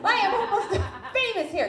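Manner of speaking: high-pitched, hammed-up insistence; desperate protest. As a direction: plead your case in a high, frantic mock wail.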